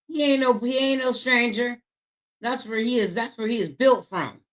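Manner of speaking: high-pitched, emphatic hype; high, excited hype; hyped-up commentary. Real, not acted. Hyping up the man on screen.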